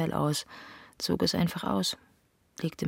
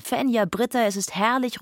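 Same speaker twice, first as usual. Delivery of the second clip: loud, much higher call to the beach; 8 semitones higher, 5 dB louder than usual. Acted. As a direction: shout across the waves, overjoyed.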